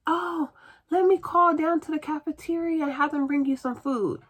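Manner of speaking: high, put-on helpful nurse voice; imitated nurse. Nurse offering patient food after complaint, imitated in high voice. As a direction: mimic the nurse, pitched well up. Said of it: "my nurse all of a sudden appears".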